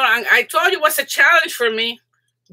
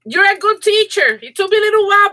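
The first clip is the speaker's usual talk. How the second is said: high-pitched, thrilled gushing; excited high voice; 8 semitones higher, 4 dB louder than usual. Real, not acted. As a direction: voice jumps up with excitement, a bit louder. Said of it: "my voice goes high because I get all excited".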